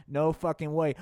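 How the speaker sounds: ordinary talk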